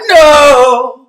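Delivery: loud, high anguished "No!"; over-the-top sobbing scream at the end of the ballad; hammed-up wail. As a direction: belt out a fake heartbroken cry. Climax of mock love song.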